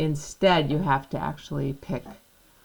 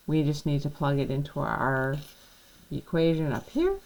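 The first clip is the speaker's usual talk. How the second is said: ordinary talk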